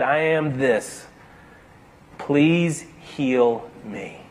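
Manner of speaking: barely ask, laying it all bare; the man confessing before God, quietly; humble plea. A narrator's reading.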